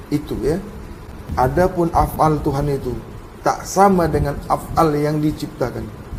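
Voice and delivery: low, soft, reverent preaching; hushed preaching. Acted. Preacher telling students what God is not, in a low, soft, reverent voice. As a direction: drop down low and soft, intoning each line.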